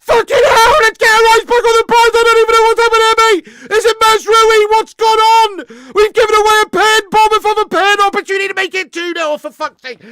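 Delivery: real high-pitched screaming disbelief; outraged shouting. Real, not acted. Fan reacts to his team giving away a penalty, outraged shouting. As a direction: yell in horror at full volume.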